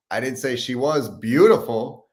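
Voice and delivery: beautiful punched high and loud; stress on beautiful. Shown as a demonstration. Coach ends the six versions, stressing beautiful. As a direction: save the big lift for beautiful. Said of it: "you emphasize each word".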